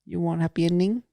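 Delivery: put-on Balinese accent, lilting up; mock Balinese hawker. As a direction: imitate a Balinese street tout's come-on. Mimicking a Bali street offer.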